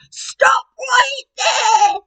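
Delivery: very high, loud, very slow shout; Granny's command. Acted. Granny bursting in with the woodcutter, loud slow shout. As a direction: order the wolf to stop. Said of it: "said Granny".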